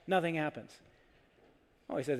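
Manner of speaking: ordinary delivery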